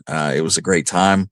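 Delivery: ordinary delivery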